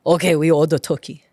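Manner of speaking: put-on dad's Korean-accented English, pitched low. Dad answering the Thanksgiving text, imitated Korean accent. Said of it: "I could hear it in his voice".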